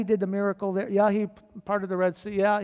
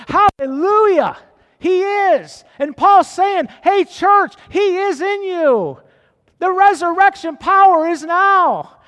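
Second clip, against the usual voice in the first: higher, louder, rising excitement; getting excited; 9 semitones higher, 12 dB louder than usual. Real, not acted. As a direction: let the joy burst out in praise. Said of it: "I'm getting excited".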